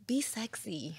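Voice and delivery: soft, seductive whisper; teasing come-hither whisper; sultry whisper. Shown as a demonstration. Advice given in a sultry whisper.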